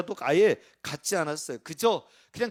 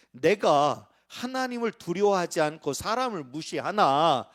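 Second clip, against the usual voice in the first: scripture reading; 2 semitones higher, 4 dB louder than usual. Acted. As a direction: read the judge's words out with weight. Reads scripture aloud. (천천히 힘주어 성경 낭독)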